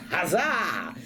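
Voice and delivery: ordinary talk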